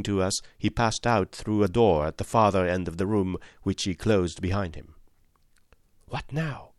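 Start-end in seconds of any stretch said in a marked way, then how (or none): 6.1-6.7 breathless unvoiced whisper; hushed whisper to a companion. A narrator's reading. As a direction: keep it secret from the next room. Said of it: "whispered Holmes".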